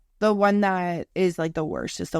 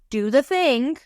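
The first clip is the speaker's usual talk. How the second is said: bossy body voice; 8 semitones higher, 5 dB louder, slower than usual. Retold or imitated. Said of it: "your body's like".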